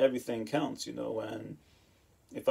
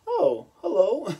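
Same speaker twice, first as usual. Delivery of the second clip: slow, much higher, taken-aback hello; shocked hello; 13 semitones higher, 7 dB louder, slower than usual. Retold or imitated. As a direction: caught off guard by an old flame. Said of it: "she was shocked".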